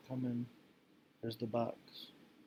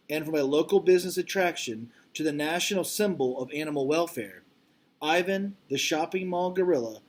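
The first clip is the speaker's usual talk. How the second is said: louder, expressive read-aloud voice; reading aloud to class; 4 semitones higher, 6 dB louder than usual. Acted. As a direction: read it out like a storybook for kids. Teacher reads book jacket aloud.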